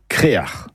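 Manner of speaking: créar in two syllables with a throaty ending; correct Breton pronunciation. Shown as a demonstration. Teacher gives listeners the real Breton word, correct pronunciation. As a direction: say créar slowly, Breton final consonant. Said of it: "la bonne production est crère ou créar".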